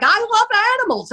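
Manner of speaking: high, fast, mock-sweet quoting of her; gushing girlfriend impression. Mocking the wild date who keeps a genet, high gush. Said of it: "She's like".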